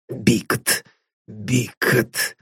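put-on old man nervously mumbles Paul's surname under his breath. A narrator's reading. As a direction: murmur the name softly, nervously, to himself. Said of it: "пробормотал старик, нервно кусая губы".

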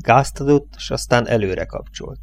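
ordinary delivery, nothing marked

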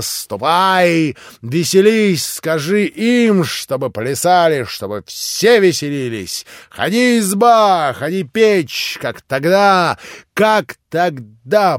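loud ecstatic exclamations; exclaiming. Acted. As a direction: urge everyone to dance. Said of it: "продолжала она восклицать".

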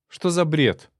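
high, loud indignant denial; fervent high-pitched protest; heated exclamation. A narrator's reading. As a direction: exclaim it hotly as the accused wife. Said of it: "воскликнула жена так горячо".

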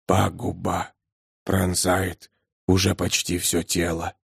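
low, hushed ominous voice; a dark verdict, quietly; low looming threat. A narrator's reading. Unknown voice pronounces diagnosis. (низкий зловещий голос)

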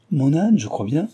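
put-on high, swooping child's exclamation; light up at a fond memory; eager and loud; delighted recognition. A narrator's reading.

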